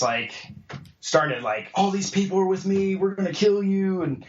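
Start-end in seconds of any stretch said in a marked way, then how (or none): 1.7-4.0 higher-pitched retold angry threat; mad threat. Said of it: "he just got mad".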